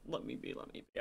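unvoiced whisper to himself; whispered aside. Real, not acted. Aside to himself mid-vlog, whispered. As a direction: breathe it under your breath.

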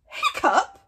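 loud surprised question; can't believe the name; incredulous. Acted. Gobber reacting in loud disbelief.

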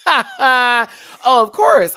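high, loud burst of laughter through the words; laughing. Real, not acted. Host cracking up as they agree, laughing. Laughs at being spotted as non-local.

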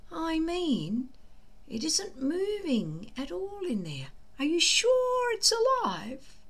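put-on high worried boyish voice; unsure friend asking if it lives; doubtful Fishlegs. Fishlegs asking Hiccup if the dragon is alive, high worried voice. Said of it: "less certain of the fate theory".

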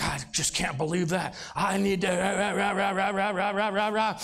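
a low grumbling rant of rah rah; mock angry muttering. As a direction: lightly play an angry mind fuming. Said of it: "their thoughts would be like".

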